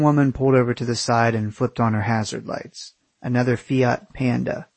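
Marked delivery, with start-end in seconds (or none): none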